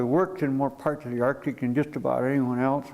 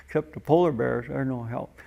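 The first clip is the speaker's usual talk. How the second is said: ordinary delivery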